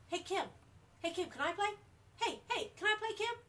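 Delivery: high excited shouting, imitating a little boy; retold kid's yell. Aunt imitating little Jonathan yelling to Kim from the sidelines, high excited shout. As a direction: call out eagerly like a small kid begging to join. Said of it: "ran up and down the sidelines and yelled".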